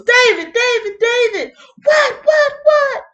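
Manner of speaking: high, loud shocked shout of a name. Retold or imitated. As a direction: yell his name in disbelief. Saul calls out in shock.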